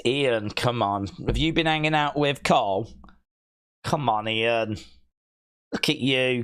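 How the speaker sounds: normal talking voice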